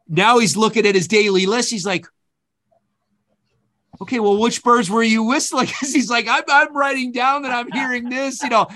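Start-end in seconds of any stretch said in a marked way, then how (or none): 4.0-8.7 high-pitched mock exasperation; exasperated birder. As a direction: imitate a fooled birdwatcher, pitched way up and drawing out the list. Retold mentor realizing he was fooled.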